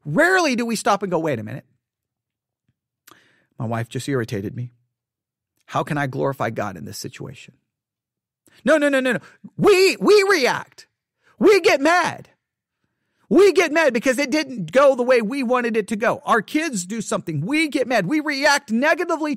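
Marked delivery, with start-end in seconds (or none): 1.2-7.5 soft, lower inner question; pausing to ask yourself quietly; quiet self-talk. Pretend. Imagined calm self-talk. 8.6-16.2 hammed-up angry reaction; mock outburst. Preacher mocking angry reaction.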